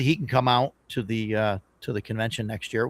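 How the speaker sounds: normal talking voice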